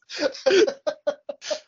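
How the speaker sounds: high laughter dying down; last giggles after the joke; trailing laugh. Real, not acted. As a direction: let the laugh fade out. Laughter continues.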